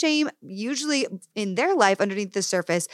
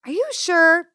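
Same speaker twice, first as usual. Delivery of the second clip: mock incredulous challenge; 11 semitones higher, 6 dB louder than usual.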